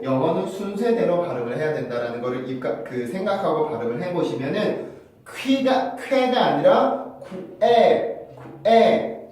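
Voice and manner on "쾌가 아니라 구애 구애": 구애 drawn out slowly, two syllables; 구애 sound. Shown as a demonstration. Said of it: "구애의 느낌이 난다". (구애처럼 천천히 발음)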